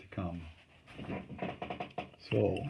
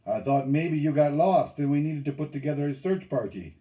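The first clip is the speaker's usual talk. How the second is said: loud, raised-pitch ribbing; teasing call; 4 semitones higher, 7 dB louder than usual. A narrator's reading. Lapetite mocks the last canoe in, calling out. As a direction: needle them from a distance. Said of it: "he called".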